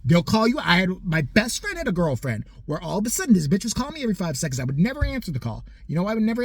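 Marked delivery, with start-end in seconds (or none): none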